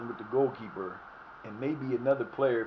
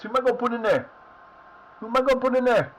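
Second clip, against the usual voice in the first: high, loud panicked question; 9 semitones higher, 7 dB louder, faster than usual. Real, not acted. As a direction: panic about who replaces him. Panicking over an injured player.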